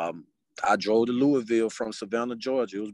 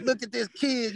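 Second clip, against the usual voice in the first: mock-impressed coach, pitched way up; impressed coaches; 11 semitones higher than usual. Coaches at the tryout marveling at the recruit, high amazed voice.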